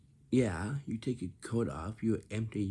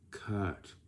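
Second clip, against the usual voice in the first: lazy flat cut; 2 semitones lower, 4 dB quieter than usual. Shown as a demonstration. Director muttering a lazy "cut.". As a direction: mumble "cut." with no effort at all. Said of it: "you sound like this".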